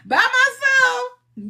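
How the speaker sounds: high, drawn-out words breaking into laughter; laughing self-mockery. Speaker admits hosting all alone, laughing self-mockery. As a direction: stretch it out high, cracking up.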